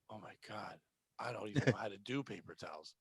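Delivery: mocking whiny coworker. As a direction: mumble it like a helpless slacker. Coworkers mocking Ralph behind back.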